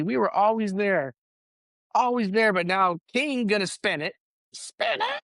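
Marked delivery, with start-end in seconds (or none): 4.5-5.2 a high, incredulous echo of the phrase; mocking echo. Ranting podcaster mocks the king's spin, high and incredulous. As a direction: scoff at the idea of spinning it.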